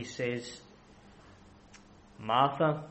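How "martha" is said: tender call. Acted. Jesus's tender call to Martha.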